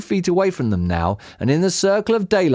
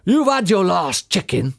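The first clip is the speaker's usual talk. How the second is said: higher character voice, mild yell; 6 semitones higher than usual. A narrator's reading. Boggis threatens the fox, yelling. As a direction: holler the threat with modest volume. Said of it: "yelled Boggis".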